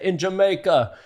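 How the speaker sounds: put-on laid-back Jamaican accent, slow; lilting Jamaican accent. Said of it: "How was my Jamaican accent".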